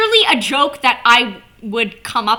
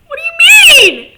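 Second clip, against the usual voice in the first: high, loud outraged cry; disbelieving shout; 14 dB louder than usual. Retold or imitated. Fan hearing the game is gone, mock outraged shout. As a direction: yell it in mock outrage at the news. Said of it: "I'm like".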